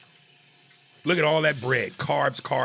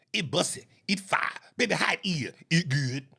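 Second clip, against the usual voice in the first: higher-pitched mimicry of a Southern couple; mock childlike talk; 4 semitones higher than usual. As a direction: rattle off their catchphrases in a put-on babyish voice. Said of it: "You talk like a child".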